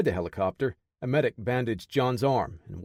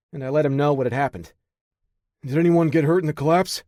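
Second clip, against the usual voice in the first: lightly voiced eager young tech, a bit higher; a hint of a keen young radio operator; young tech Parker; 5 semitones higher, 6 dB louder than usual.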